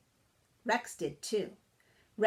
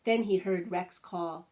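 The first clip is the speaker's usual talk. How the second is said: ordinary delivery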